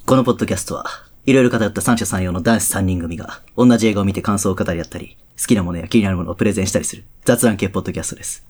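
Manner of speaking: low, composed host voice; podcast intro read. Pretend. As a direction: read the show intro deep and unhurried. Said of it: "逆に[name]読んでもらって、その後の感じでいく？".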